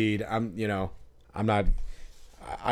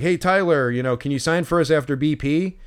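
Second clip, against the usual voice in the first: louder, higher call across the field; yelling out; 5 semitones higher, 4 dB louder than usual. Retold or imitated. Fan calling to Tyler Wells before batting practice, loud and higher. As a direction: call out to get the player's attention. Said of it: "So I yell out".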